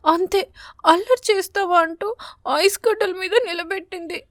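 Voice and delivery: put-on a small sparrow girl sobbing out her story; tearful little girl. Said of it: "మళ్ళీ ఏడవడం మొదలుపెట్టింది". (ఏడుస్తూ చెబుతున్న చిన్నారి గొంతు)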